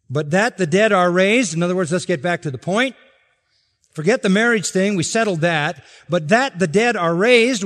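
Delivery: loud, rising preacher's voice; preaching at full force. Acted. Preacher quoting Jesus to the crowd, loud and rising. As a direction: preach it out to the congregation.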